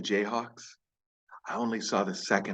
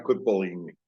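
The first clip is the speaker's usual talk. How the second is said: ordinary delivery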